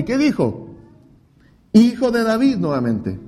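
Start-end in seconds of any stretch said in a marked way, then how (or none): 1.7-2.6 mock cry out. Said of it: "Clamó aún más fuerte".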